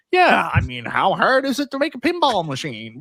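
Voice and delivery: high-pitched cocky imitation, breaking into laughter; mock hubris. Overconfident newcomer shrugging off building pinball machines, mock hubris. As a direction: brag like a clueless show-off, pitch climbing. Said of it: "the hubris to be like".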